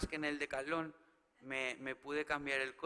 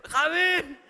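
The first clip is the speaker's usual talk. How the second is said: higher, louder swooning call of the name; swooning crowd shout; 12 semitones higher, 13 dB louder than usual. Shown as a demonstration. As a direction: yell it like a smitten fan. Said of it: "sentiros un poco Celia".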